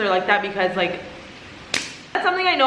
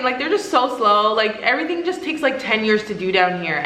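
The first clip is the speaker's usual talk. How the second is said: usual speaking voice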